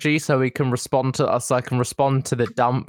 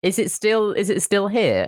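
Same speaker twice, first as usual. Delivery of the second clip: higher than usual; higher pitched voice; 6 semitones higher than usual. Real, not acted.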